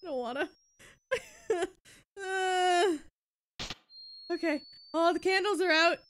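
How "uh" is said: nervous laugh-cry. Real, not acted.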